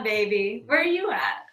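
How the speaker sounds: ordinary delivery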